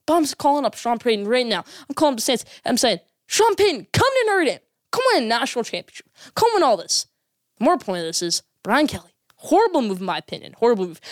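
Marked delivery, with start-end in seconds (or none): 3.3-7.1 high-pitched, urgent appeal to a coach; eager recruiting pitch. Acted. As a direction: coax him hard, voice jumping up. Pleading phone pitch to a coach.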